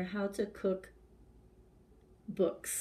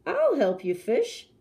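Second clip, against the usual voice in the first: kindly king offering; 5 semitones higher, 4 dB louder than usual. Acted. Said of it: "said the hungry king".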